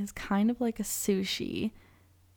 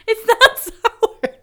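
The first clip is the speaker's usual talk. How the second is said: laughing; 13 semitones higher, 11 dB louder, much slower than usual. Real, not acted. Laughing while denying it tastes sour.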